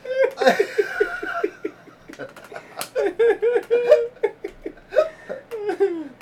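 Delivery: long, high cackle with a teary edge; high howling laugh. Real, not acted. Laughing hard at his own Irish joke, howling laugh. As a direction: crack up until it almost turns to crying.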